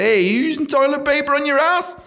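high, loud comic holler; mock shouting. Staff member challenging patient in the toilet, mock shout. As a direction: yell it as if through a door.

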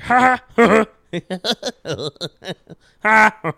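loud, high staccato cartoonish laugh; Pee Wee Herman laugh. Showing off a famous loud, high comic laugh. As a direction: punch out big high "ha" bursts. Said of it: "I do Pee Wee Herman's laugh".

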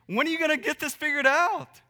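high, loud impatient scolding; mock exasperated yell. Parent yelling at a baby who won't walk: loud high scold. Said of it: "yell at them like".

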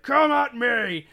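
loud, high, slow taunt; defiant battle cry. Retold or imitated. Paladin surrounded by undead taunting them, loud and slow. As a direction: dare the horde to attack. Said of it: "I was like".

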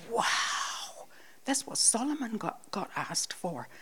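hushed, whispered inner thought; retold thought under the breath; awed whisper. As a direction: whisper it in wonder.

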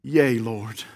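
low, soft, humble assent; simple answer. Acted. Blind men answering Jesus, simple quiet yes.

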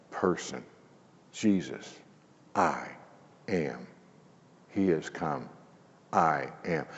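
very soft, slow, reverent "I am"; hushed awe. Acted. Preacher hushing to a reverent whisper on "I am". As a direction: drop to a near whisper in awe.

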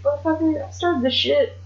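high-pitched, playful mock outrage; mock indignant outburst. [name] turning on his partner, mock indignant.

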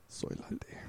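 breathy whisper of soy latte; a sly muttered soy latte; whispered pun. Retold or imitated. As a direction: whisper it under your breath. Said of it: "I'll be like".

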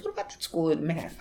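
quick pointed question as Dad; father prodding son about the Manhattan school; father asking. Retold or imitated. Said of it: "He goes".

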